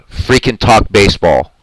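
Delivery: ordinary delivery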